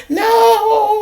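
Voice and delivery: high, loud shriek of no; hollering no. Retold or imitated. Woman hit by the taser, loud high shriek. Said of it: "she started hollering".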